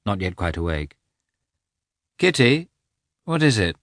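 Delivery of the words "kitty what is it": higher, questioning call to his wife; puzzled calling out. A narrator's reading. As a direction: call out, wanting an answer. Husband wakes, calls to wife.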